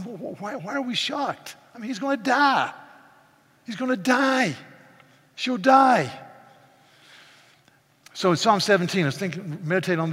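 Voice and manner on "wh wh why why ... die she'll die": incredulous retort. Retold or imitated. Preacher acting his own retort.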